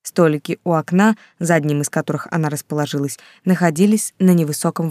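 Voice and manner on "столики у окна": ordinary delivery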